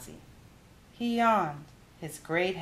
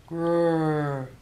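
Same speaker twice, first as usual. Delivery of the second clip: bear growl; 4 dB louder than usual. A narrator's reading.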